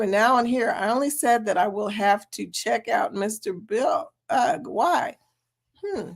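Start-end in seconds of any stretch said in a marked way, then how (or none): none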